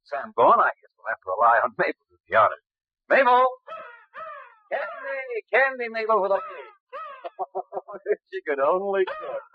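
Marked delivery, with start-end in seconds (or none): 3.1-3.4 high, loud call across the room. Acted. Calling the bird. 4.7-6.4 high coaxing sing-song; lilting high call to a pet. Acted. Coaxing the bird with candy. 6.9-8.2 high laugh. Acted. Laughing at the bird.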